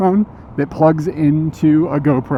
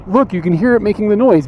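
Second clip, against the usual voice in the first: mock insistent, pitched higher; 6 semitones higher than usual. Streamer pounding the box, mock insistent. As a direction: push it like he's pounding the box. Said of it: "pounding on the box, trying to say".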